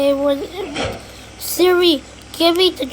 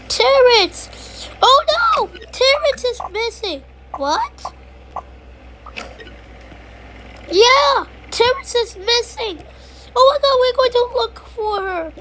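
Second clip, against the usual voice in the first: higher, alarmed pretend panic; mock panic; 7 semitones higher than usual. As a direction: play at being frantic. Panicking about missing dog.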